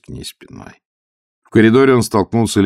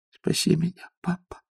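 weak, higher whisper; 11 semitones higher, 6 dB quieter than usual. A narrator's reading. Sick daughter whispering to her father. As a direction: breathe it out weakly. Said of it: "прошептала".

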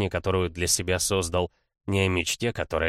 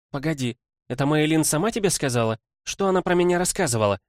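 flustered; 8 semitones higher, 4 dB louder, faster than usual. Acted. Embarrassed sister presses brother.

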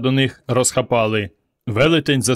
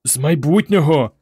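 ordinary delivery

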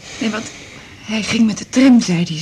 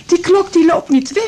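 higher, louder, rising in anguish; the protest spills out of her; distraught outburst; 9 semitones higher, 6 dB louder than usual. Acted. New widow recalling her warning, voice breaking upward.

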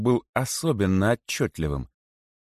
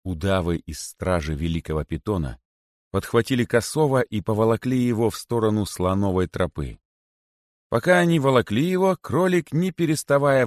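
plain reading voice

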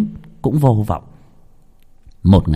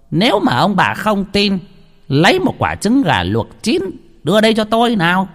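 higher healer voice giving an order, fast at the end; a healer setting up his proof; commanding healer; 9 semitones higher than usual. A narrator's reading. Healer tells the couple to bring an egg, commanding.

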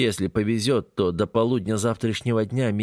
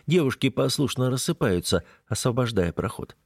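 plain reading voice

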